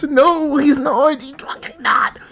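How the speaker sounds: outburst rising into a high squeal; real frustration, climbing to a strained shriek; so angry. Real, not acted. Fan denying he's doing well, angry and tearful. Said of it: "I'm so angry".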